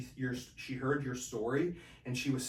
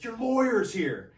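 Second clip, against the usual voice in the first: called out announcement; 9 semitones higher, 8 dB louder, slower than usual. Retold or imitated. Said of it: "He's like".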